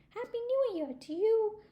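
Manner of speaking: high-pitched, excited squirrel greeting; cheery well-wishing. Acted. Squirrel greets Mister Turtle at New Year, cheery well-wishing. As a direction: wish it brightly, way up high.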